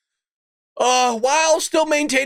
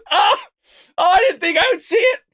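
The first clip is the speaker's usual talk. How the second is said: amused astonishment; 7 semitones higher, faster than usual. Real, not acted. Laughing shock at the news.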